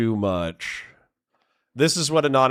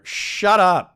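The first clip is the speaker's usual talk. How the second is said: loud, higher-pitched dismissal; mock-angry snap; 7 semitones higher, 5 dB louder than usual. Real, not acted. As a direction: shout down a heckler. Snaps at a chatter.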